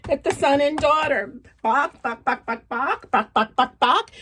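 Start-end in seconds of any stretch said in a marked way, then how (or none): 1.6-4.1 chicken clucking. Acted. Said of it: "began to noisily peck".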